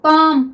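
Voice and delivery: teacher demonstrating पाम; model pronunciation. Shown as a demonstration. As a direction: model पाम clearly for the class. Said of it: "पाम, क्या है students?".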